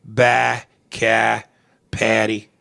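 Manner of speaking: Boston stretched A's, R's dropped; Boston accent. Shown as a demonstration. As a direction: demonstrate Boston vowels word by word. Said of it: "they stretch their A's".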